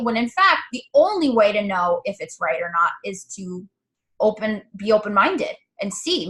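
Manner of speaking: ordinary talk, nothing marked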